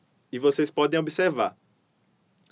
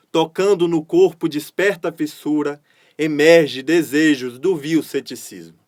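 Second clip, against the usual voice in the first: louder, rhythmic galloping poem reading; poem recital; 5 dB louder than usual. Acted. Teacher reading a poem aloud to her class, declaimed with a galloping rhythm. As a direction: declaim each line to the steady gallop beat.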